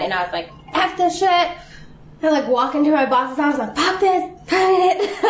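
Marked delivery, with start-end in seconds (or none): 0.7-1.3 high-pitched mock-furious burst; half-joking angry outburst; retold mad outburst. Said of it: "but I got really mad". 3.8-5.2 high, drawn-out mock fury; half-joking angry outburst, pitched up; retold mad outburst. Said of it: "I got really mad".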